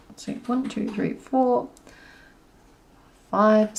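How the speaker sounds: counting softly to herself; hushed count, slowing down; quiet counting. Real, not acted. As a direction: murmur each number as you stitch. Counting stitches.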